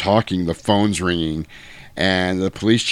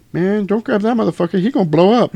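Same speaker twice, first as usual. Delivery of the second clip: much higher, louder gangster warning; Crip voiceover; 12 semitones higher, 4 dB louder than usual. Retold or imitated. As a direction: warn the buddy street-style. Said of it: "voiced by a Crip".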